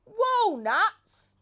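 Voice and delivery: high, loud excited monkey scream; monkey overjoyed at walnuts; shrieking with delight. A narrator's reading. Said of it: "screamed the monkey".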